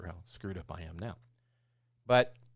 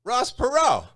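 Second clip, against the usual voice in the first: loud, high nasal Texan impression; Ross Perot; 12 dB louder, slower than usual. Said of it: "come up at the end of every sentence".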